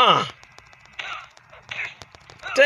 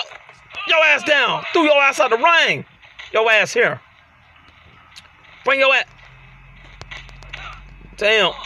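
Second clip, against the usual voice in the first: ordinary delivery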